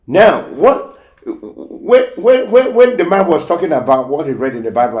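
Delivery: high, loud, fast climbing repeats; fired-up preaching. Real, not acted. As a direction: build the sermon up with urgency. Preacher builds to next point.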